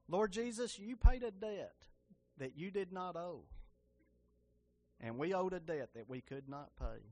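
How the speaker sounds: earnest spoken prayer to Jesus. Acted. Preacher leading the church in prayer, earnest.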